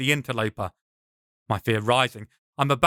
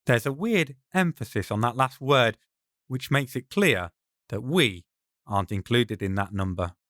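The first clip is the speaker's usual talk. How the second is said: ordinary delivery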